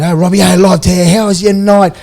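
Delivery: loud, high, hyped-up on-air welcome; radio host greeting. Retold or imitated. Radio hosts welcoming Robbie live to air, hyped-up. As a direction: boom it out like a breakfast host.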